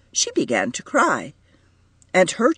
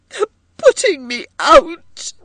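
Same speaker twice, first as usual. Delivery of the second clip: high, loud tearful wail; distraught woman's high cry; 6 dB louder than usual. A narrator's reading. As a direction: wail it as if in tears. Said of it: "she wailed".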